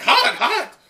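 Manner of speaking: high, loud yelp; hammed-up villager cry; panicked villagers. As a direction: squeal like a burnt bystander. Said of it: "the other villagers are like".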